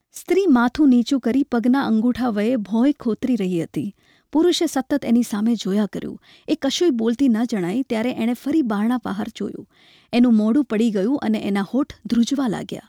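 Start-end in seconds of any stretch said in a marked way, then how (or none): none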